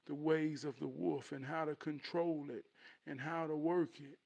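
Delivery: soft, lightly voiced dad; gently steady a frightened child; reassuring father. Father promising to teach his son, softly reassuring. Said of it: "So his daddy said".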